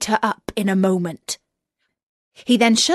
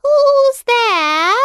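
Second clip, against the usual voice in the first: put-on much higher and louder old woman calling; old grandmother voice; 8 dB louder than usual. Grandmother answering the knock at her door, high and loud. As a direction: call out high to the door like a frail granny.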